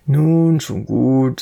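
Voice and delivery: loud, firm call to order; serious. A narrator's reading. Boy stops his friend's laughter, serious. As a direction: cut the merriment off sternly. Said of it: "sagte Mischa ernst".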